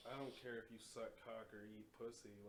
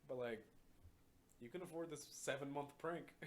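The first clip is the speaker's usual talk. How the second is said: exasperated; 4 semitones higher, 5 dB louder than usual. Retold or imitated. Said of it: "I'm like".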